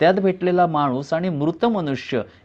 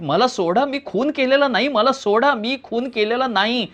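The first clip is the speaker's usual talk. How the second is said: mock frantic yell, pitched higher; desperate shouting; 6 semitones higher than usual. A narrator's reading. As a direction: plead wildly to be let go. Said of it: "तुम्ही वेड्यासारखं परत परत ओरडत राहता". (पोलिसांपुढे गयावया करत ओरडणे)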